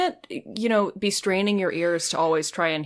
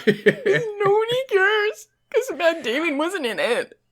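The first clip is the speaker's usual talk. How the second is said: laughing quote; 12 semitones higher, slower than usual. Retold or imitated. Quoting Ben Affleck, laughing.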